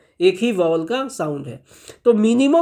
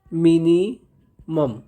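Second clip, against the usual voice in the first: Minimum very slow, broken as mini, मम; syllable by syllable; 3 semitones lower, much slower than usual. Shown as a demonstration. Teacher dictating a key term, very slow and broken up. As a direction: stretch minimum out with a gap before the last syllable.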